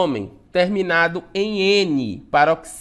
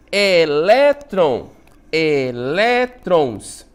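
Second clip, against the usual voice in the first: "Elétron, elétrons" very slow, in separated syllables, LÉ punched; stress on LÉ; 4 dB louder, much slower than usual. Shown as a demonstration. Teacher showing the sílaba tônica, slow and stressed on LÉ. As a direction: draw out each syllable, leaning on the "lé" of "Elétron, elétrons". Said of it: "sílaba tônica lé".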